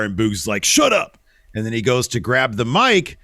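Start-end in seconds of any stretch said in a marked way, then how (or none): 0.6-1.2 mock snap. Boogs snaps at Sammy.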